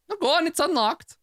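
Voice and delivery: high mock-friendly call. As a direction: wave her on cheerfully. Doctor calls to Lucia.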